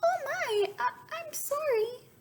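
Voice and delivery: very high, small sorry voice; the bird apologizing; apologetic bluebird. A narrator's reading. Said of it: "apologized the bluebird".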